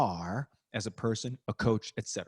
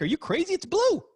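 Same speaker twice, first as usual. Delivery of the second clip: mock disbelief. Imitating himself arguing the sky is blue, mock incredulous. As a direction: squeal it like the other person is nuts. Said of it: "'cause I'm like".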